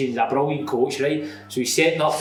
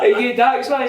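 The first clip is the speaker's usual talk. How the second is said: higher, louder mock-timid question; hammed-up shy question; timid man imitated; 8 semitones higher, 5 dB louder than usual. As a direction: put on a timid man's approach, laughing through it. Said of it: "how timid he was".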